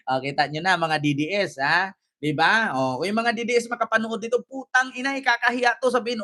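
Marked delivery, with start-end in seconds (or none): none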